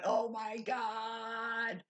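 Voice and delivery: drawn-out, very slow relieved sigh; blissful sigh. Retold or imitated. Recalling the bliss of her first shower, slow sighing relief. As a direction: melt as the warm water hits. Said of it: "I'm just sitting there going".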